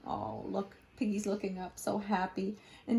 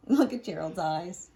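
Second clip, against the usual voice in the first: usual speaking voice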